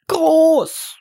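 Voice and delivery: hohe, helle Drachenstimme, ängstlich; kleiner Drache. A narrator's reading. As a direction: spricht als kleiner, verzagter Drache. (hohe Stimme des kleinen Drachen, der Max seine Angst gesteht)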